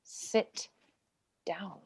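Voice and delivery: hushed, breathy command to sit; a whispered "sit down" to the witness; whispered order. Acted. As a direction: hiss it at him under your breath.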